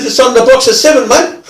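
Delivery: high, loud, insistent mate; worked-up offender. Retold or imitated. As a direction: imitate an agitated bloke pressing his point. Imitating offender desperate to see film.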